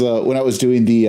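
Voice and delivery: normal talking voice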